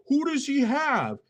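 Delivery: mocking quote. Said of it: "and say".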